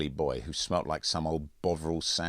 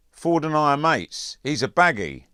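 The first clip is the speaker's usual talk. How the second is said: louder, higher boast; bragging bully; 10 semitones higher, 9 dB louder than usual. Acted. Andrews boasting to Mitchell about Ford, loud and high. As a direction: brag loudly to put him down.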